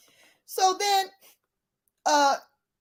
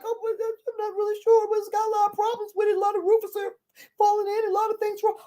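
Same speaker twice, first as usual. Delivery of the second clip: much higher, put-on old lady voice; hesitant old lady; 9 semitones higher than usual. Old lady putting off the eager buyer, high hesitant imitation. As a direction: imitate a lady stalling with excuses.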